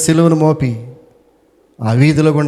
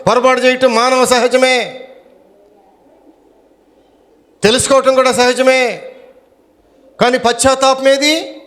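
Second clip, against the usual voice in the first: soaring preacher's declaration; fervent preaching; 8 semitones higher than usual. Acted. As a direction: proclaim a second chance to the whole church. Climax after calling for applause.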